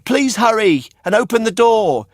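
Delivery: put-on high, loud little boy calling at the door; high pleading puppet. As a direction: hurry them up, high and pleading.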